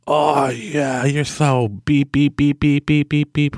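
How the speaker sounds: mocking whine. Contractor mocked for calling the speaker names, in a mock whine. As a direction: sneer out his complaint, stretched and singsong.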